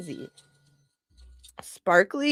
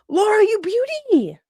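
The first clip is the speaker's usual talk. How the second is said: high, loud excited call; excited shout-out; 12 semitones higher, 3 dB louder, faster than usual. Real, not acted. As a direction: cheer her name out. Excited greeting to chatter.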